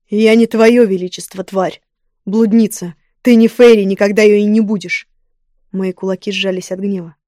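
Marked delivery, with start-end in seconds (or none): 0.1-5.1 loud, furious royal contempt; angry. Acted. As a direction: spit out scorn at her. King rages at the woman.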